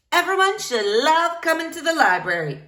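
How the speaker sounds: light take on a big-hearted librarian; lightly voiced bold librarian. Rosalita voiced by reader.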